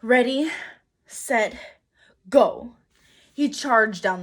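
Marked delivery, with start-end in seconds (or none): 0.0-2.8 louder countdown before charging; psyching up. A narrator's reading. Jack counting himself down before charging down the hill, louder. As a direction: steel yourself, then launch on go.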